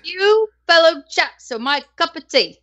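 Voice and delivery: put-on a Southern girl putting on posh British; Southern Belle British. Reading shout-outs to stream chat in a Southern-tinged British accent. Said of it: "your Southern Belle British accent".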